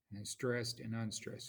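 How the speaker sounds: usual speaking voice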